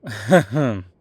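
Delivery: short burst of devilish laughter; loud wicked laugh. Acted. Pleased listener gets it.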